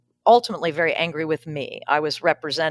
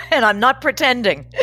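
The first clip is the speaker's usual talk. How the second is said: laughing through tears; 5 semitones higher, 5 dB louder than usual. Real, not acted.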